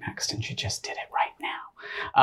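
a quick whispered aside to listeners; whispering. Real, not acted. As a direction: lean in and whisper it conspiratorially. Aside to audience about the guest.

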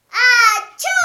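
big acted sneeze; loud sneeze. Sneeze in story.

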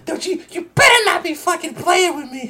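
high mock-angry girlfriend voice. Girlfriend voiced angrily in story.

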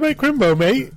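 hammed-up British bloke; British accent. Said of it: "They're like".

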